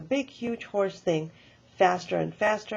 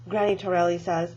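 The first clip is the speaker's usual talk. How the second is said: plain reading voice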